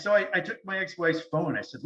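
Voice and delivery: ordinary delivery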